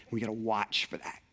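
hushed whispered warning; dad warning the kids about the cat in a whisper. Retold or imitated. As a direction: whisper it like a secret. Dad warns kids conspiratorially.

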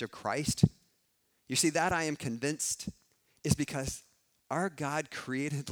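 loud, pressing preacher's build; preaching at full force. Acted. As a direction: drive the conviction home to the room.

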